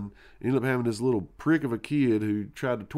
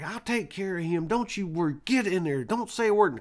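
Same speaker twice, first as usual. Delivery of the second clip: stern grandma; 8 semitones higher than usual. Retold or imitated. Grandma sending the boy to his room, higher and building scold. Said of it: "She's like".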